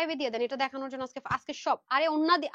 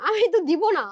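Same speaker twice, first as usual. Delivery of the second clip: louder and higher, pressing the point home; emphatic louder repeat; 4 semitones higher, 8 dB louder than usual. Real, not acted. Firm louder refusal. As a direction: seller insisting to viewers, firmly louder.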